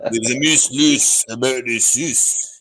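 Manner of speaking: slow put-on Scottish accent. Showing off accents to the panel, slow mock Scottish. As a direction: drag out a mock Scottish line slowly. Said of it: "I did loads of accents".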